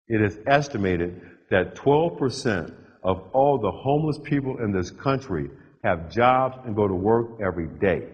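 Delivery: ordinary delivery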